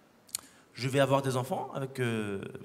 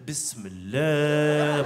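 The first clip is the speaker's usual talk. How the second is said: very slow, stretched word; drawn out; 3 dB louder, much slower than usual. Retold or imitated. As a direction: drag the word out slowly. Child says magic word.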